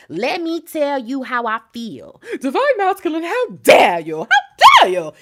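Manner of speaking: acted outrage building to a loud, high shout; confrontational attitude. Divine Feminine confronting Divine Masculine, outraged attitude. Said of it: "with an attitude about something like this".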